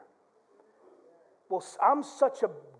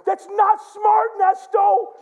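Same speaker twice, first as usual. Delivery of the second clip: high, loud, slow reprimand; drawn-out telling-off, acted; scolding wife; 12 semitones higher, 9 dB louder, much slower than usual. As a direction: imitate a wife scolding loudly. Wife scolds him, retold.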